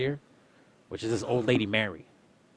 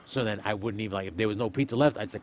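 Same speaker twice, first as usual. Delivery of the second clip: ordinary talk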